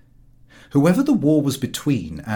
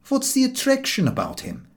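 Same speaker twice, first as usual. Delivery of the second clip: landlord; 12 semitones higher than usual. Acted. Landlord voiced in story.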